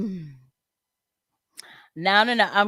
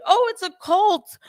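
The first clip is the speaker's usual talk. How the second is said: high, loud mock of a scoffing critic; 12 semitones higher, 5 dB louder than usual. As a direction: toss it off like a sneering critic. Mocking quote of Trump critics.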